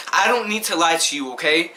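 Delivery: usual speaking voice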